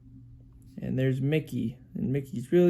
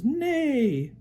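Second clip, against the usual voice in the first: high, drawn-out whinny falling away; horse neigh; 12 semitones higher, 3 dB louder than usual. Acted. Parent showing what the horse says, horse neigh. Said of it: "The horse says".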